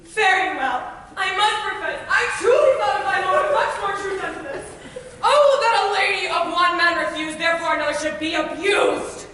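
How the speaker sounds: loud, laughing bitter protest; hurt fury through bitter laughter; indignant outburst. Acted. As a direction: rage at being mocked. Helena feeling mocked.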